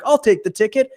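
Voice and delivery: high-pitched imitation, happily accepting; breezy retold reply in a high voice; eager compliance. Mimics man gladly accepting ticket.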